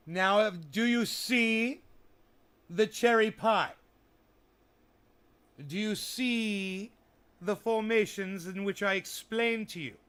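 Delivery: high, loud and slow reveal; grand reveal. Pretend. Showing the viewer the cherry pie, loud, high and slow. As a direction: proclaim it big and slow, up high.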